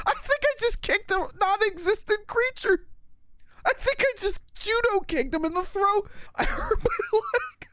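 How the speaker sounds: very high, shrieking laughter through the words; hysterical laughing. Real, not acted. Gamer laughs hysterically after kicking at the screen monster.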